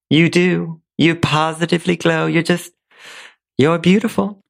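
high, heartfelt Southern belle; Blanche Devereaux. Acted. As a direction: gush praise as a jealous friend. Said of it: "In the role of Blanche Devereaux".

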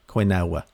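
quinoa said as "kwin-OW-uh"; imitated mispronunciation. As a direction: repeat quinoa the odd way, kwin-OW-uh in three syllables. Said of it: "as Sarah likes to call it".

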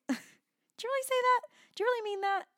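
squeaky, high-pitched disbelief; hammed-up doubtful protest in a tiny high voice; mock incredulity. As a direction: question it way up high, playfully indignant. Acts out arguing with God's rebuke.

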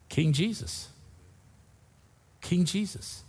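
soft, reverent, almost breathless; tender hush. Real, not acted. Tender climax naming Jesus.

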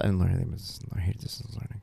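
low, rushed mimicked grumble; quick, trailing-off retold mumble; mumbling daughter. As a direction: imitate a sullen kid muttering off the mic. Daughter mumbles away from mic.

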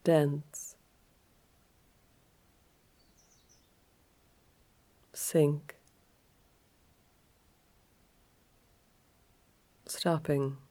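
calm yoga nidra guide, leaving space after each phrase; guided relaxation. Acted.

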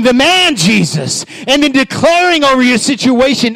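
high, loud sermon delivery; full-force preaching. Real, not acted. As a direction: drive it home to the congregation.

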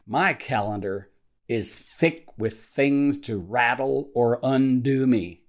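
measured devotional reading with deliberate pauses between phrases; slow prayer reading. Real, not acted. Pastor reading a morning prayer to devotional listeners, slowly and deliberately. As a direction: read the prayer slowly, setting each phrase apart. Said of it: "I'm gonna read it slowly".

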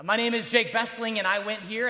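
ordinary delivery

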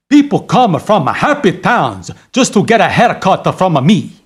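put-on heavy Italian accent. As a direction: talk like an Italian barber. Italian barber throughout.

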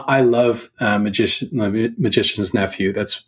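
normal talking voice

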